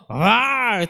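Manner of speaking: his own angry retort, hammed up; mock roar back. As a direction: roar back even harder. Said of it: "Sabi ko".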